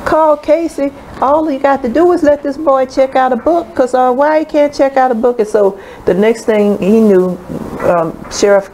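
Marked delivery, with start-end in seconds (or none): 0.0-5.4 put-on higher, indignant old man; defiant grandfather. Grandfather defying the librarian, higher and indignant. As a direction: throw the challenge back at her.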